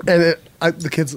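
usual speaking voice

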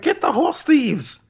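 high-pitched, drawn-out mock yell. Sheriff yelling at the plumbers, imitated high yell. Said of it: "he yells at the plumber".

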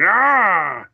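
high, drawn-out "ah" of frustration; frustrated shout. Retold or imitated. Retelling the villain's reaction when his troops turn on him, frustrated shout. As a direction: imitate a villain's frustrated yell. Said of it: "this frustrated, like, shout".

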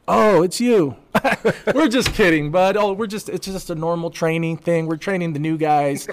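high, loud, cheerful cop teasing; hammed-up amused officer; mock cop, laughing it off. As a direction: play the cop chuckling that it's only training. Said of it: "And then he just".

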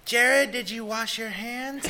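put-on high, slow little girl voice; man imitating a little girl. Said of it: "a little girl's voice".

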